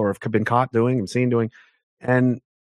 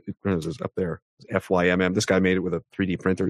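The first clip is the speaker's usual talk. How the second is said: ordinary talk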